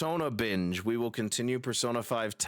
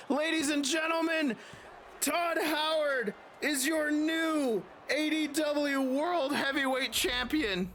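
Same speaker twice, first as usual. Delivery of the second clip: slow, higher big-moment announcement; wrestling commentator; slower than usual. Acted. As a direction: proclaim the new champion. Announcing the new champion.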